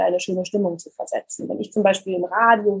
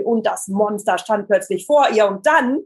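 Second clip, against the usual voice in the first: "und dann" lifted and left hanging in suspense; rising suspense. Shown as a demonstration. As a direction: build tension up to a raised "und dann", as in storytelling. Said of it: "ob ich hochgehe und vielleicht Spannung erzeuge". (spannungsvoll, mit der Stimme bei „und dann“ hochgehen)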